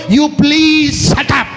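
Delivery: slow, drawn-out mock rebuke; a mock scolding, dragged out slowly; scornful shut up. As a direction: tell the critic off, stretching each word. Preacher mocks a critic.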